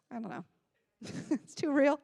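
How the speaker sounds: ordinary delivery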